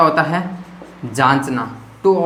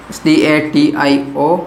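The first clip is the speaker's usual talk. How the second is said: spelling out; 3 semitones lower, much slower than usual. Shown as a demonstration. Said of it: "teacher spells the word letter by letter".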